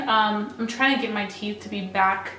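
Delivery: ordinary delivery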